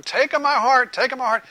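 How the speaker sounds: mock-plead to be chosen; crowd shouting. Crowd under the floating feather, lightly shouted plea. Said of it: "they're shouting".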